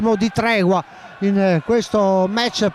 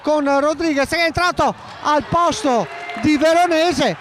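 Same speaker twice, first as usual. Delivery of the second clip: urgent warning; 6 semitones higher than usual. Real, not acted. Sudden substitution warning.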